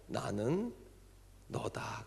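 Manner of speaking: soft, hushed divine words; a soft whisper of revelation; gentle voice of God. Retold or imitated. God speaking softly to the deaconess, hushed. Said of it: "그러시더래요".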